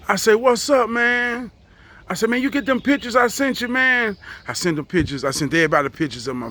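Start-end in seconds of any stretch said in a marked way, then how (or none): 0.3-4.1 high, quick eager phone greeting. Retold or imitated. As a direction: pitch up, talk fast and eager.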